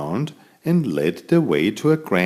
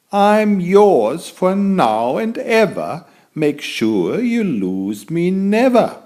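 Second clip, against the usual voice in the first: higher, slow, louder magic-tree voice; talking wand branch; 9 semitones higher, 4 dB louder than usual. Acted. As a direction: promise it like an enchanted twig. Magic branch answers the student.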